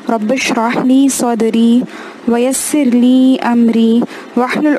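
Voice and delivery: slow, measured recitation of a dua; solemn Arabic invocation, drawn out; opening prayer. Acted. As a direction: intone it slowly like a prayer.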